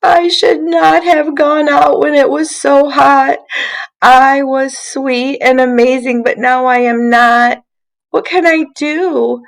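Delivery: a sad little stick about to cry. Acted. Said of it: "this sad little stick cried".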